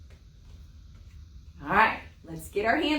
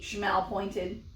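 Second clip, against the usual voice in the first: plain reading voice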